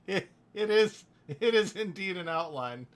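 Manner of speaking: high, loud, through real laughter; laughing. Real, not acted. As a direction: talk through a big laugh. Bursts out laughing at remark.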